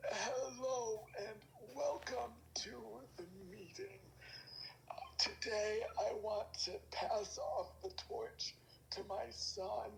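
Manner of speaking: slow, calm voice of an ancient elf; unhurried elder's welcome, slow and gentle; calm old elf. Acted. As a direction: speak like a wise old elf handing on a legacy. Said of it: "he had this really calm old voice".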